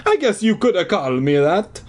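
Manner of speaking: hammed-up stage-Italian, starting high; Italian accent. A narrator's reading. Janitor accepting the name Phantom, Italian accent. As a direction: concede it in a thick Italian accent. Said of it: "his hoarse Italian voice".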